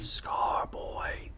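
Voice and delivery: whispered, reaching out in the mind; ghostly inner whisper; silent calling whisper. A narrator's reading. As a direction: breathe the name like a summons. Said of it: "She called in her mind".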